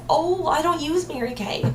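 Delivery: a hint of a reluctant client; hesitant customer objection. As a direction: play the doubtful customer, mildly. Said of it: "let's say she says".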